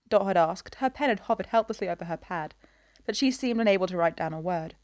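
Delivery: ordinary delivery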